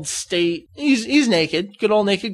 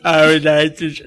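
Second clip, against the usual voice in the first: dub voice; 4 semitones lower, 4 dB louder than usual. Acted. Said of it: "dub sixteen voice".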